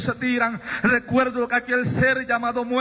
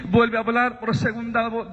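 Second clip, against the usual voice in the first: usual speaking voice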